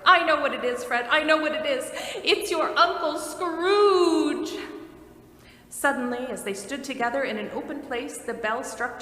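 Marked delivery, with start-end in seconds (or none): 0.0-4.6 loud, high, eager guessing shout; excited cry. A narrator's reading. Plump sister crying out the answer at the game. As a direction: blurt it out like a triumphant guesser. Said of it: "the plump sister cried out".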